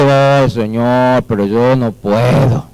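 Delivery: low, slow, dragged-out reluctance; feeble excuse-making. Acted. Lazy servant telling God he cannot, slow and reluctant. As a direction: stretch the "oh" and plead inability slowly.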